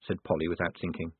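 ordinary delivery

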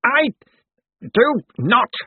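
high, loud, halting outburst; indignant denial. A narrator's reading. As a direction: force out each word in protest. Cosmo furiously denies being ill.

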